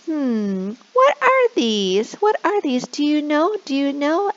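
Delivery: high, slow, amazed questions; playful wonder. Shown as a demonstration. Teacher voicing the monkey to coax shy students. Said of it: "And you can say".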